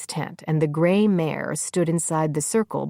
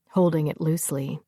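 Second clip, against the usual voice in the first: ordinary delivery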